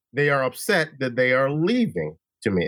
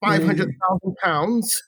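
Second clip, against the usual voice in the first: hammed-up fake London accent; terrible British accent; 3 semitones higher than usual. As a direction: put on a bad British accent, drawing out the end. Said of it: "That accent is terrible".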